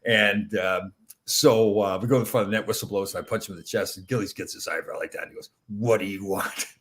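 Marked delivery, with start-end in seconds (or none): 5.7-6.7 slow, menacing mock growl. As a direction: growl it slowly like a monster threatening you. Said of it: "he's growling at me".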